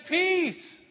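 mock peace to the driver who cut you off; sarcastic peace. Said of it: "and say".

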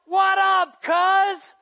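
loud, high playful greeting yell; excited shout. Retold or imitated. John greets cousin Jesus with a high excited shout. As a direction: call out to your cousin across the water.

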